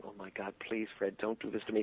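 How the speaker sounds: a quiet plea of mock despair; hushed panic. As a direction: beg under the breath. Panic as Fred starts talking.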